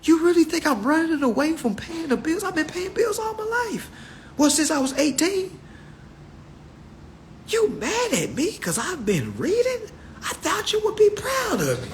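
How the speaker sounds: high, fast, wounded protest; hurt protest. Retold or imitated. Reader pleading with family who mock him, high hurt protest. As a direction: plead like your family betrayed you.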